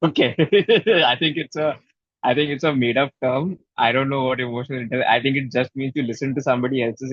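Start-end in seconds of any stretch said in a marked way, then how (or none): 0.4-1.0 a quick high burst of laughter; short laugh. Real, not acted. Speaker laughing at his own poker joke, quick laugh.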